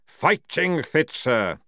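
loud, high, slow bark. A narrator's reading. Sergeant answering the general with a loud bark. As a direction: bark it out at attention. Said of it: "barked Jackram".

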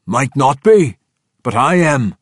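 high, loud gruff declaration; commanding dwarf. Acted. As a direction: announce yourself from behind. Bruner arrives.

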